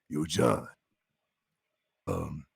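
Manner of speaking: deep, low, soft big-guy voice; Billy's voice. Retold or imitated. Grown-up Billy inviting John to acting, deep low voice. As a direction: sink the pitch down like Billy. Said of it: "That's how he talks".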